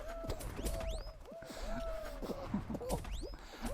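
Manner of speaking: long squealing laugh, very high; breathless high laughing fit. Real, not acted. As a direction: dissolve into helpless laughter. Laughing fit after game failure.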